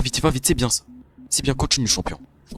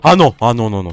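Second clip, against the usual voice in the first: ordinary talk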